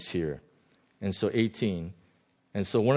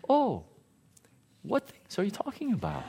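higher, softer, with a laugh; gentle innocent ask; 9 semitones higher, 5 dB quieter than usual. Retold or imitated. Jesus sweetly asking the scolding disciples what things. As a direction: ask it sweetly, playing dumb. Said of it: "It's like".

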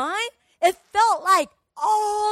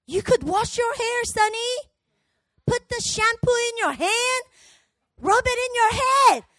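higher, firm parental instructions; loving rebuke; 4 semitones higher, 5 dB louder than usual. Acted. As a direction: coach a child step by step, insistently. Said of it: "loving rebuke".

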